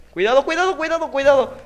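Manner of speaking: high, loud urgent repeats; tense warning. Real, not acted. Gamer urging himself past danger, high and loud. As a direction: warn yourself in a rising panic.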